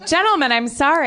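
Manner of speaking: high, quick, startled apology; startled lady apologizing, pitched high; flustered apology. Acted. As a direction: placate armed guards in a flustered rush. Startled player placates yelling guards.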